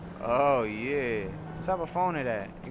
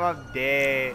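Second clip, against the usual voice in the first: ordinary talk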